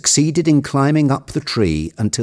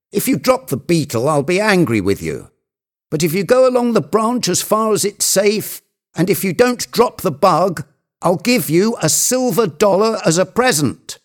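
high, urgent bargaining; warn, then coax at a high pitch; insistent Legrand; 10 semitones higher than usual. Acted. Legrand warning and bribing his climber, high and insistent. Figure of the story threatens, bargains.

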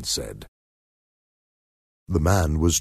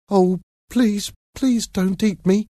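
put-on high-pitched little boy begging; tearful pleading; 13 semitones higher than usual. A narrator's reading. Boy begs not to be eaten, fighting back tears. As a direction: plead in a high, frightened child's voice. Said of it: "trying not to cry".

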